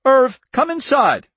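high, loud, drawn-out call; crying out. A narrator's reading. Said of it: "Norma cried".

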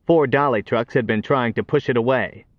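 plain reading voice

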